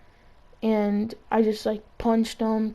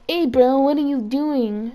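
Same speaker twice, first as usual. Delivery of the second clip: put-on stuffy-nosed kid; 3 semitones higher, 3 dB louder, slower than usual. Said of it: "sounded like they had a stuffy nose".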